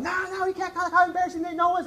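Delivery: high, loud frantic pleading; mimicked panic. Wife stopping husband calling the cops, imitated high and frantic.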